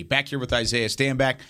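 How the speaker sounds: ordinary delivery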